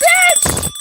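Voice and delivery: very high exclamation of disbelief; indignant outburst. Acted. Reacting to auntie spoiling the compliment, high indignant yelp. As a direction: burst out high, playing up the outrage.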